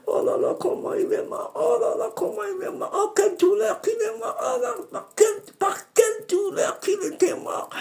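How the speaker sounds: high, crying moan of pain; high wailing lament. Retold or imitated. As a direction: wail over his death. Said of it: "il se plaignait comme ça". (le djinn se lamente : plainte aiguë en pleurs)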